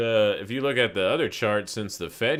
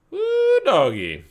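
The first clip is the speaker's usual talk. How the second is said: high loud woo; excited whoop; 10 dB louder, slower than usual. Real, not acted. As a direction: whoop at the crash. Exclaims at big dump.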